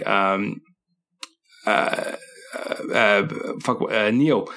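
groping for a name, stalling; brain freeze. Real, not acted. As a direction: mind gone blank mid-sentence. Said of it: "I got a brain freeze right now".

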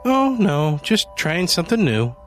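high, chirpy and cheerful; breezy happy shopper; bright cheerful. A narrator's reading. Cheerful Janie at the store.

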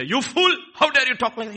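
loud, high-pitched acted outburst; angry rebuke. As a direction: explode in a scolding shout. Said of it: "He didn't say".

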